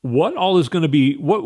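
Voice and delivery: ordinary talk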